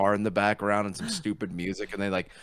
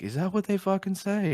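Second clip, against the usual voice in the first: much higher retold disbelief; incredulous mock outrage; 13 semitones higher, 5 dB louder than usual. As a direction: scoff in disbelief. Retold argument over gold rings.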